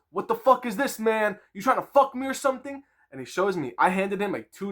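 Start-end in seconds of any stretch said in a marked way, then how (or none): 0.1-2.8 high, louder angry demand; angry retold accusation. Nico confronting the buyer about the bill, angry high-pitched. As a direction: confront him like you've been cheated. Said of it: "He goes".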